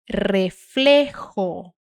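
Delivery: "reflejo" drawn out, J a rasping throat h; forceful Spanish J. Shown as a demonstration. Teacher drilling a word, "reflejo" very slow with rasped throaty j. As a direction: stretch "reflejo" and rasp the j like an angry cat's hiss. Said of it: "você vai fazer isso com força".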